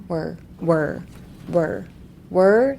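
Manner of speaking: short "were" repeated, resting back in the throat. Shown as a demonstration. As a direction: keep each "were" in the mouth, unpushed. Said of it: "喉の奥にありますよね".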